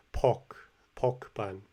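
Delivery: "Pork" said without the r, like "pawk", slowly; non-rhotic r. Shown as a demonstration. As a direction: drop the r in "Pork", "pawk bun". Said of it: "the non-rhotic R".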